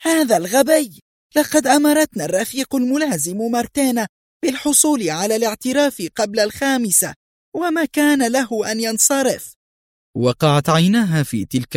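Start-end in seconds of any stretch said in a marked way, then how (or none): 0.0-9.5 very high-pitched enraged outburst; frenzied cry. A narrator's reading. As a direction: rant in a high, agitated cry. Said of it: "صرخت في هياج". (صراخ هائج بنبرة عالية جدا)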